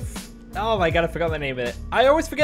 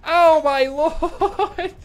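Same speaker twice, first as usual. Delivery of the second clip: laughing; 11 semitones higher, 5 dB louder, much slower than usual. Real, not acted. Amazed at teammate spraying.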